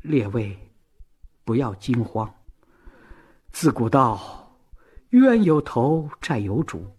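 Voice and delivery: steady the crowd with unhurried composure; calm, composed. A narrator's reading. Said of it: "雪雁从容地说".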